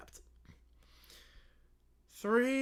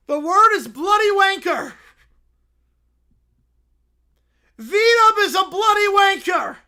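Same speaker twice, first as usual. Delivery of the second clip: put-on high loud childish yelling; bratty kid screaming; 9 semitones higher, 12 dB louder than usual. Caillou shouting curses at his co-hosts on air. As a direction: scream the insults like a tantrum. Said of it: "Please stop screaming directly into the mic".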